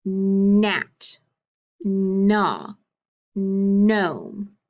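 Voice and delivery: long held N opening "Gnat", "gnaw" and "gnome"; "Gnat", "gnaw", "gnome" each begun on a drawn-out hummed N; stressed initial sound. Shown as a demonstration. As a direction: stretch the first N of "Gnat", "gnaw", "gnome", with silent G. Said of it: "in these words make that sound".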